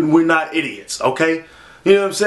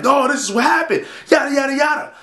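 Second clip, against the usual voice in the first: much higher mocking voice; mock average person; 10 semitones higher than usual. Mocking how a normal person would explain it. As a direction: parody a casual explainer, pitched up.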